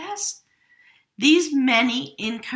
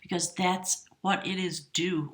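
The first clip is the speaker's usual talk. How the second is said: normal talking voice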